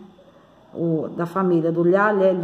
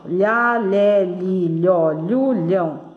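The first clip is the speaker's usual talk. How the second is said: lhá, lé, li, lhó, lhu, lhão drawn out in a connected run; syllable drill; much slower than usual. Shown as a demonstration. Teacher leading pupils in repetition, drawn-out syllable recitation. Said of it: "vamos repetindo aqui".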